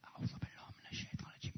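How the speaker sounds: whispered quickly under the breath. Shown as a demonstration. Preacher whispering the prayer so the neighbour won't hear. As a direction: breathe the words without voice. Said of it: "یواش بگو".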